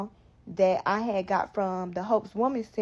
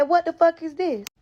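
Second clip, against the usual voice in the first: high, loud outraged demand; angry mom; 8 semitones higher, 6 dB louder than usual. Retold or imitated. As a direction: yell it like a mom who just found out. Mom opens ultrasound envelope.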